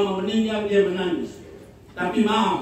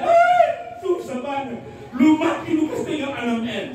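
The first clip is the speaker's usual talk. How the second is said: mock shrieking lament; 7 semitones higher than usual. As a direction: shriek the complaint through laughter. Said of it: "Dia tambah berteriak". (teriakan ratapan yang lucu, nada sangat tinggi)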